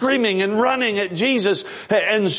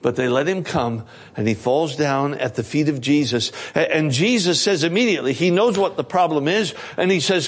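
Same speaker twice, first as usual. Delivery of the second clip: ordinary delivery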